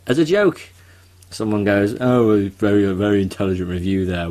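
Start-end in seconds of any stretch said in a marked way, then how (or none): 2.0-4.3 sarcastic. Retold or imitated. Mocking a sarcastic YouTube commenter.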